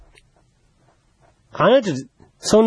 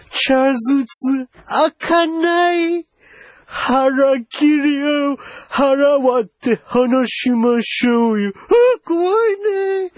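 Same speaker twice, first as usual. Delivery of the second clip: mock horror; 8 semitones higher than usual. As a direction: wail about it in exaggerated dismay. Acted horror at life under isolation.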